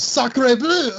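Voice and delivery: high, loud cartoon French yell; French accent. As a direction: shout it like a comic Frenchman. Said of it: "one of them yells".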